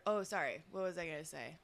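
normal talking voice

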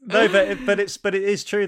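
loud laughing protest. Real, not acted. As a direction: protest loudly, half laughing.

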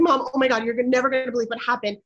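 fast, loud, breathless rush of news; running upstairs to tell mom the lost bird is found, excited and fast. Retold or imitated. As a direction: blurt it out to mom, racing.

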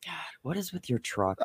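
hushed exasperated whisper. Real, not acted. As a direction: breathe it out under your breath. Partner grumbling about the truck.

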